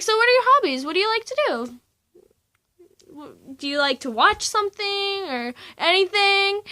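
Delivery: mock a chirpy adult asking about hobbies; put-on cheery counsellor. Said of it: "She didn't have that voice".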